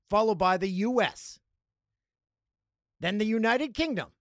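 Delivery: normal talking voice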